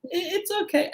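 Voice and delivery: high, soft hesitant reply; husband giving a sheepish answer; meek. Retold or imitated. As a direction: shrug it off timidly, high and quiet. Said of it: "I'm like".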